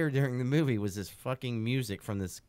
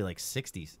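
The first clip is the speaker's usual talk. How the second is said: ordinary delivery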